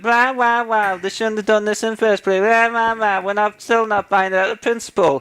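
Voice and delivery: high, mock whining "rah rah" voice; mocking whiny complainers. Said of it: "They said".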